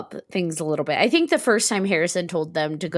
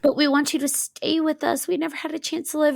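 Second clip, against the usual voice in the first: put-on ghost kids pleading; 9 semitones higher than usual. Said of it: "They're like".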